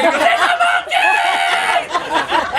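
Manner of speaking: very high, drawn-out comic scream; mock shriek. Coffee girl spotting the monkey, mock shrieking. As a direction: shriek it slowly at the top of your range. Drive-thru worker reacting to monkey.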